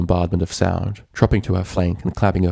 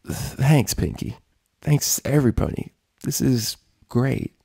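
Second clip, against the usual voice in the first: rough labored voice; 6 semitones higher, slower than usual. A narrator's reading. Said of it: "Her voice was rough and labored".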